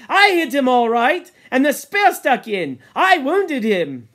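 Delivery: loud, high, thrilled boasting; excited. Acted. Ralph talking on about hitting the boar, excitedly. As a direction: pour it out proudly. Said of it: "Ralph talked on excitedly".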